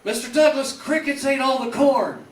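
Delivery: high and loud, the line blurted out again. Retold or imitated.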